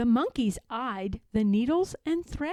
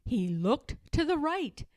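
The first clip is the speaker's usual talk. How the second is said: ordinary delivery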